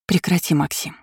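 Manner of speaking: lightly hoarse, strained request to stop; hoarse plea. A narrator's reading. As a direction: croak it out, understated. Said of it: "прохрипела я". (негромко, с хрипотцой)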